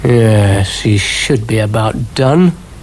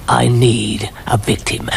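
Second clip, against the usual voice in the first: slow whisper; villain whispering his plan; sinister whisper. Acted. Mad scientist plots.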